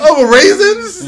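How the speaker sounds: loud, slow, high disbelief; incredulous shout. Retold or imitated. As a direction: drag it out in shock. Mock outrage over raisins.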